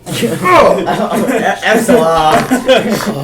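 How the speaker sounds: high, loud laughing exclamation. Real, not acted. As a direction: cackle out the word excellent, slowly. Player bursts out laughing.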